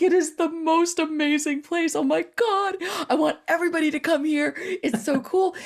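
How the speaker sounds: high, bubbly rush of delight; girl's delight. Retold or imitated. Girl describing heaven, high excited gushing. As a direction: gush about heaven like a thrilled teen. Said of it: "she's like".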